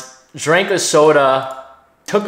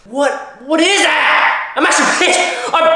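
mock outraged yell; 10 semitones higher than usual. Real, not acted. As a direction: yell in disbelief, playing it up angry. Reacting to rat voice clip.

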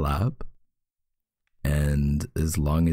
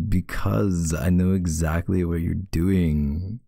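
ordinary talk, nothing marked

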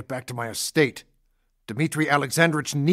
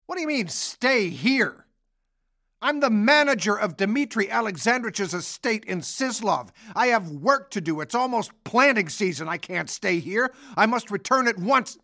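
indignant protest; 8 semitones higher than usual. Acted. Angry patient refuses to stay.

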